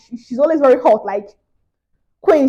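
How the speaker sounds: ordinary talk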